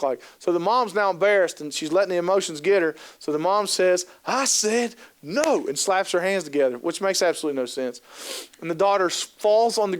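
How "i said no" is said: slow, drawn-out higher "no"; stern mom. Retold or imitated. Mom refusing her daughter in the aisle, slow stern no. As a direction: lay down the law, stretched out. Mom shouts no, slaps hands.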